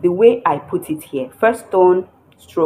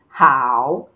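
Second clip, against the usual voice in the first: "Hao" drawn through a dip and back up; falling-rising tone; 3 semitones lower, 3 dB louder than usual. Shown as a demonstration. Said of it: "this is the falling and rising tone".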